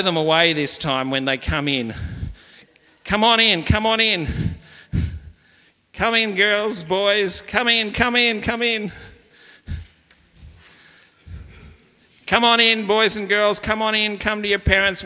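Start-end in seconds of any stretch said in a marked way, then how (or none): 3.0-15.0 welcoming call. Real, not acted. Pastor greeting children filing in, high cheerful welcome.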